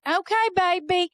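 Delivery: high, slow, drawn-out placating; humouring. Retold or imitated. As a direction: imitate relatives indulging a small child. Relatives humouring the child.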